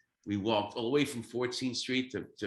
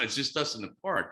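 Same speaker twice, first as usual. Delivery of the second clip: ordinary talk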